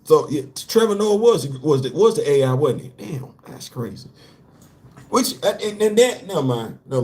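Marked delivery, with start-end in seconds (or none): none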